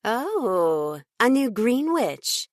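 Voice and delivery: a hint of a posh British woman sizing someone up; slight British accent. Fiona greeting the new green witch client, slight British accent. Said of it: "noting her slight British accent".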